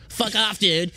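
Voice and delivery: high, squeaky mock voice; mocking troll. Streamer mocks a troll's comment in a high squeaky voice.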